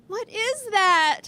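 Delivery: put-on high, loud child's shout; slow, loud startled kid cry. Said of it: "one student shouted out".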